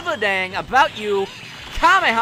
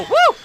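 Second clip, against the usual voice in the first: excited whoop; 7 dB louder than usual. Real, not acted. Celebrating transformation.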